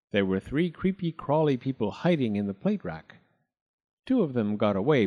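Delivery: plain reading voice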